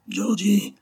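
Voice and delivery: high, full-lunged cry of a name; dying man calling out high; shout. A narrator's reading. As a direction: yell the name with the last of his breath. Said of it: "cria-t-il de toute la force de ses poumons".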